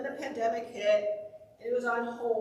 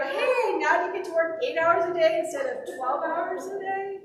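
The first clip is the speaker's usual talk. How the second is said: playful ribbing, pitched higher and louder; teasing; 4 semitones higher, 5 dB louder than usual. Retold or imitated. Sister teases her brother about selling his company, playful ribbing. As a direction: tease him about being an employee now. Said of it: "I was teasing my brother".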